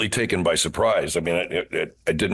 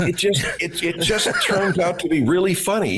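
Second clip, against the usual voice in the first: laughing; 10 semitones higher than usual. Real, not acted. Laughing while answering.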